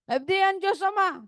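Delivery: high, lightly voiced old grandmother answering; grandmother's voice. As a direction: play the grandmother answering with sure pride. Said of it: "grandmother answers".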